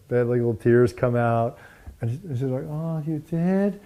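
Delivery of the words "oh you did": high, drawn-out mock lament; mock tearful. Teachers hearing she hated her mother, mock tearful. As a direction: imitate a slow, tearful question, pitched up. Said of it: "And they're crying".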